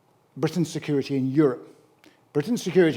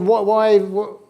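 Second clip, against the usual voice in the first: mock outraged spluttering; 4 semitones higher, 3 dB louder than usual. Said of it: "It said".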